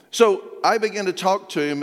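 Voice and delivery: normal talking voice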